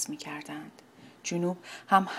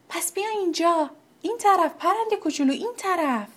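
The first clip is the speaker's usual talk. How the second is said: much higher and quicker, kindly beckoning; friendly calling; 10 semitones higher, 4 dB louder than usual. Acted. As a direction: coax the cold bird this way. (صدا زدن دوستانه و بلند)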